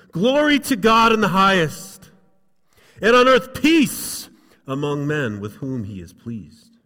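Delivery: booming angelic praise; loud, with authority. Acted. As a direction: proclaim it with authority like a heavenly host. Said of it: "they said it loudly, they said it with authority".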